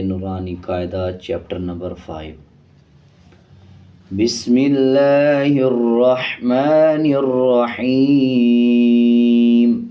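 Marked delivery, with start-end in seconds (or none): 4.1-9.8 slow, drawn-out chanted Bismillah; recitation. Acted. Teacher opens the Quran lesson with measured recitation. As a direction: intone it slowly like a prayer.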